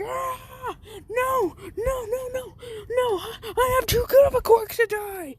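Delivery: very high, shouted panic; panicked falling scream. Retold or imitated. Bakugo falling out the window, high shouted panic. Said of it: "he's like".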